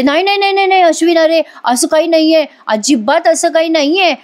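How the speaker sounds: a touch of imitated panic; panicked denial. Priya frantically denying to her husband, scared. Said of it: "Priya बघा घाबरली".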